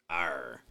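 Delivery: low pirate arr; pirate growl. Acted. Pitching a pirate shirt slogan, low pirate growl. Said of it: "It'd be a piratey thing".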